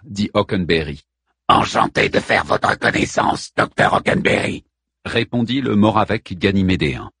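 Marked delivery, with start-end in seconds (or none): 1.5-4.6 grinding rasp. A narrator's reading. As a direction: voice like a washer full of stones. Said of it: "grayonnait comme une lessiveuse chargée de cailloux".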